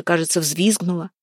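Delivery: plain reading voice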